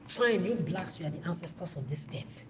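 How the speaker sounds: ordinary talk, nothing marked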